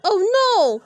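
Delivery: high, loud alarm; terrified cry. Acted. Boy to friends, as fire rains down. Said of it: "cried Harold".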